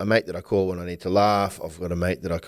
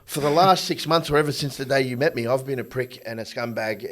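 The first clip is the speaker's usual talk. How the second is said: loud, higher, incredulous protest; exasperated retort; 5 semitones higher, 5 dB louder than usual. Retold or imitated. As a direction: argue back in disbelief. Man retorting to his partner.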